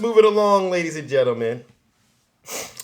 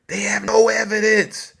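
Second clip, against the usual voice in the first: whispered aside, as if sharing a secret; hushed, conspiratorial jab; mock whisper; 5 semitones higher than usual. Aside mocking the opponents' claims.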